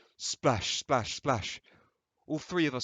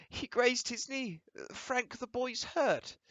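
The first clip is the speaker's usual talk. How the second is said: much higher, slow fretting mother voice; 13 semitones higher, slower than usual. Acted. The mother tells Frank the boy is hurt, fretting. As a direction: fuss over the boy's knee.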